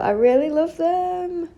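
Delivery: high, slow, delighted; really mean it, beaming; gushing. Real, not acted. Gushing over new shoes.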